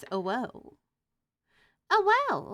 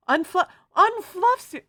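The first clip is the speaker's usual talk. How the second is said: much higher and louder delighted yelp; excited squeal; 11 semitones higher, 16 dB louder than usual. Real, not acted. Streamer reacts to a viewer's plush, excited squeal. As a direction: squeal at the fluffy surprise.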